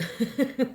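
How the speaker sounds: loud, quick self-amused laugh. Real, not acted. Vlogger laughing at her own swear word, amused laugh. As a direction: burst out laughing at yourself.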